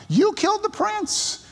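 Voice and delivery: very high, strained retold accusation; exclaimed accusation. Preacher repeats Peter's charge to the leaders, very high-pitched accusation. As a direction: drive the pitch right up as you accuse. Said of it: "put a little exclamation point".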